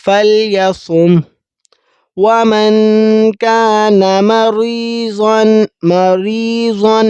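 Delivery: slow melodic Quran chanting; Quran recitation. Acted. (قرآن کی تلاوت، آہستہ اور لحن کے ساتھ)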